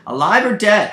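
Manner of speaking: high, loud call in a put-on character voice; loud, high-pitched stranger's hail; new role's voice. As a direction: call out urgently, pitched well up. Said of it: "He plays a new role, new accent".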